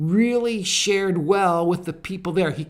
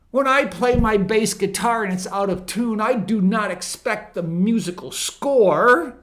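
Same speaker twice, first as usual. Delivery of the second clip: higher-pitched, emphatic pulpit delivery; impassioned preaching; 7 semitones higher, 4 dB louder than usual. Retold or imitated. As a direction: preach the quote, building to a raised cry. Preaches a quoted answer.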